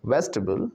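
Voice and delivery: वेजिटेबल squeezed to three syllables, VEJ-tuh-bul; correct syllable break. Shown as a demonstration. Teacher models वेजिटेबल for class, broken as vej-tbul. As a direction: drop the middle vowel in वेजिटेबल: vej-tbul. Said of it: "टेबल नहीं, ट्रिपल।".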